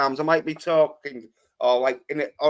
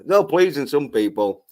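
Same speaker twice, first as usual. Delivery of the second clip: ordinary talk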